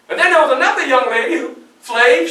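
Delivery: ordinary talk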